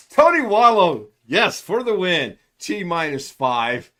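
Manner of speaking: loud, high cheer for a viewer; cheering loudly; excited cheer. Real, not acted. Cheers a viewer's chat joke.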